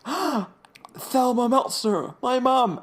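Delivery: high, slow, startled cry; shocked gasp. Retold or imitated. Retelling Eugene finding his mother's grave, shocked. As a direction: gasp it out as if seeing a ghost.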